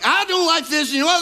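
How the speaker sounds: high, whiny put-on pout; a pouty spouse griping; mock whiny complaint. Spouse griping mid-argument, mock whiny. Spouse venting in a married quarrel.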